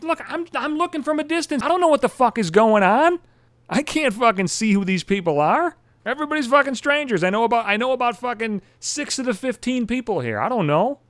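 high-pitched, exasperated protest; flustered self-defence. Retold or imitated. Storyteller excusing his mistake to a friend, flustered. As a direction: defend yourself in a rising fluster.

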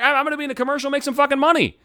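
high-pitched, hyped-up hope; excited. Acted. Actor imagining landing a commercial, excited. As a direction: act out getting carried away with excitement. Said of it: "Then you're excited".

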